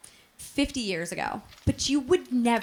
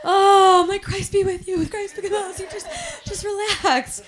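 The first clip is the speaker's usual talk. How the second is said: mock frightened prayer; 6 semitones higher than usual. Praying over possessed grandma.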